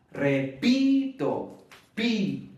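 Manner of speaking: "Repito" drawn out slowly on the syllable "pi", then "pi" alone; stress on PI. Shown as a demonstration. Teacher showing learners the tonic syllable, stress on "pi" in "Repito". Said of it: "esta es la sílaba tónica".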